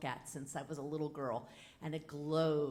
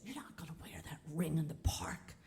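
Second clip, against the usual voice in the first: hushed whisper; whispered warning; 5 dB quieter than usual. Retold or imitated. Girlfriend warning her in the park, hushed whisper. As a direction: whisper it like a secret caution. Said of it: "And my girlfriend says".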